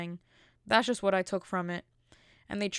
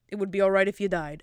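louder, mocking the governor's words; mock callous quote; 6 dB louder than usual. The vlogger mocks the governor's cruel remark, louder and scornful. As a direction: throw the governor's cruel line back, louder. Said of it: "that governor".

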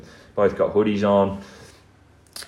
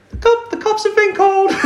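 very high, loud imitation; mock shrieking partner; 6 dB louder than usual. Storyteller imitating his partner calling out to intruders, high mock shriek. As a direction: screech it as a comic impression.

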